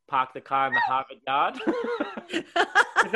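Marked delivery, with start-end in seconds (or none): 0.1-1.6 park the car line in Australian take on Boston accent, louder; Boston-style Australian accent, lilting up on "yard"; Australian-Boston likeness demo. Shown as a demonstration. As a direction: show Boston-like vowels in Australian accent, YARD rising at the end. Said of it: "we talk like Boston people".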